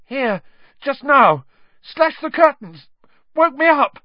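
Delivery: very high, loud panicked bursts; terrified. Acted. Ron tells his roommates about the attack, high panicked cries. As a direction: cry it out in short, frightened phrases. Look of the utmost terror.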